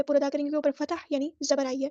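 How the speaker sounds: usual speaking voice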